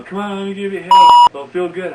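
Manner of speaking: put-on loud high coaxing girl voice. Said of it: "she goes".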